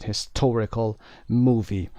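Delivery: "Historical movie" drawn out slowly, stress clearly marked; slow stress demo. Shown as a demonstration. Teacher repeating "Historical movie" for students, slow and deliberate. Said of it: "استرس رو دقت داشته باشید".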